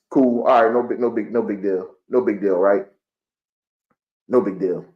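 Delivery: low, dropping pitch, fake-casual shrug; deadpan mock-indifference; sarcastic no big deal. Real, not acted. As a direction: pretend it doesn't sting. Sarcastic shrug after losing.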